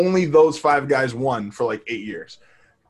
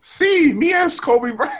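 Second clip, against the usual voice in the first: mock basketball star; 4 dB louder, slower than usual. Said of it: "(you'll be like)".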